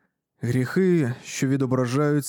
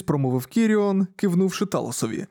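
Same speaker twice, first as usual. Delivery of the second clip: ordinary delivery, nothing marked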